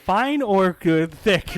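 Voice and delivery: usual speaking voice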